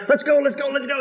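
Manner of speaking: impatient churchgoers itching to go; eager urging. Retold or imitated. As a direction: push the pastor to hurry up. Eager members urging the pastor on.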